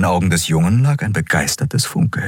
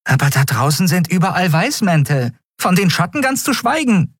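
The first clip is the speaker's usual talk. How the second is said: high-pitched, urgent protest; alarmed fairy companion; 13 semitones higher than usual. Acted. Companion warning captain of pursuers outside, alarmed. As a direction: object urgently in a small, high voice.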